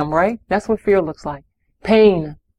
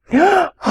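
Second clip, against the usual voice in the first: shocked gasp; 9 semitones higher than usual. Acted. Shocked parent reacting to lie.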